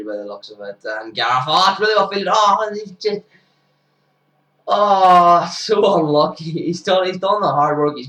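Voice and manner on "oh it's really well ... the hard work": high, loud groan of disappointment; real excitement, then settling; excited dismay. Real, not acted. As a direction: cry out at the near miss. Fielder just misses save.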